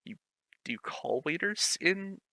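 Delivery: ordinary delivery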